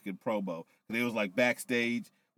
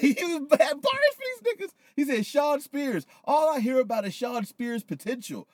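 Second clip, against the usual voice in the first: laughing retelling; 4 dB louder than usual. Real, not acted. Retelling promo, laughing, high.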